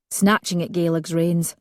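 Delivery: usual speaking voice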